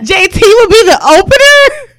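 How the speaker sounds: loud, high disbelief; can't believe it, loud; incredulous question. Real, not acted. Shocked at ranking order.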